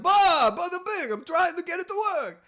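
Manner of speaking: a high, flustered Italian-American caricature; mock Italian-American. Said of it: "And he's like".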